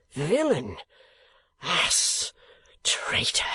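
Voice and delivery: whispered furious insults; father cursing the uncle, hushed fury; hushed curses. A narrator's reading. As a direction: spit the names out under your breath. Kenwigs cursing Lillyvick under breath.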